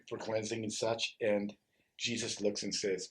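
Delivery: ordinary talk, nothing marked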